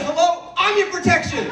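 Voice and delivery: very high, affronted squeal; indignant retort. Acted. Boyfriend claiming to be her protection, high indignant retort.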